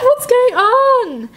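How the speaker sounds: high, drawn-out frightened cry; startled shriek. Real, not acted. Player jolted by a creepy chat story, frightened shriek. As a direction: shriek it out in alarm, stretching the words.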